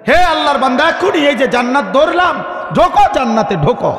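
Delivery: high, loud ringing call; angel's summons. Acted. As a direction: call out urgently to welcome someone in. Angel calls believer into paradise.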